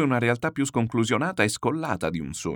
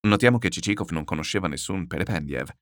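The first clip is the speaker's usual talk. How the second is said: plain reading voice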